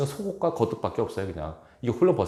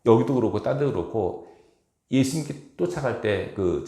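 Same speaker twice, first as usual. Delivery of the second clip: ordinary talk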